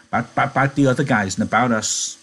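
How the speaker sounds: high, eager simple-minded giant; Lenny voice. Acted.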